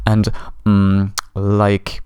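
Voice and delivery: mock hesitation on drawn-out fillers; hesitant filler words. Shown as a demonstration. Coach shows the fillers to avoid, mock hesitant stalling. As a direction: stall on "mm" and "like" as demonstration. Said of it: "avoid fillers".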